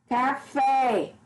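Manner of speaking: "Café" drawn out, with a long falling FÉ; stress on last syllable. Shown as a demonstration. Teacher giving an oxytone example, stress on last syllable. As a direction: lean on the final FÉ of "Café". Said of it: "é a mais forte".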